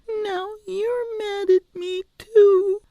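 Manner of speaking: put-on high, slow tearful child; crying, hurt by the scolding; weeping. A narrator's reading. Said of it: "wept Penelope".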